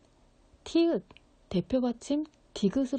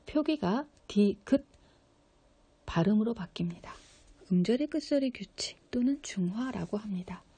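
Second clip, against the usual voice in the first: ordinary talk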